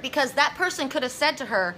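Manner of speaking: ordinary talk, nothing marked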